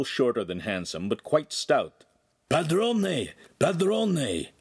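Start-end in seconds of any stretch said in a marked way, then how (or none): 2.5-4.5 high, raspy calling out; harsh, raspy call. A narrator's reading. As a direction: rasp it out to the master. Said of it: "called in a harsh, raspy voice".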